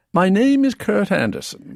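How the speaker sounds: British accent. Shown as a demonstration. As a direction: introduce yourself as a posh Brit. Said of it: "So let's try this".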